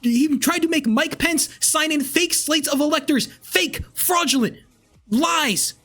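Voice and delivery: real anger boiling over into shouting; furious rant. Real, not acted. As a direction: shout him down, all-out outrage. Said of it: "I'm very fucking furious about it".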